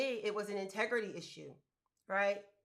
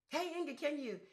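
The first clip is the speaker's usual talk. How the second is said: asker's voice; 5 semitones higher, 4 dB quieter than usual. Retold or imitated. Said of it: "mimicking someone asking a favor".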